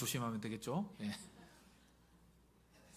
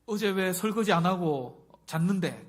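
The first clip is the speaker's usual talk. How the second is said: loud, raised mock scolding; quarrelling spouse; 5 semitones higher, 9 dB louder than usual. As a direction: pick a noisy marital fight. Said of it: "막 싸워요".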